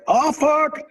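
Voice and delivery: Pavel's high shocked swear. Retold or imitated. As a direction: blurt it out in sudden shock.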